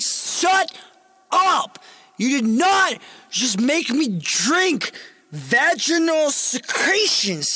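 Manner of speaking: high, slow, hammed-up yell; outraged shouting. Man scolding client over the drink, outraged shouting. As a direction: punch out each word in loud disbelief.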